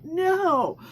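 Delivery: playful sassy scolding; mock scolding no. Real, not acted. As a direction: wag a finger at them. Emphatic scolding, higher.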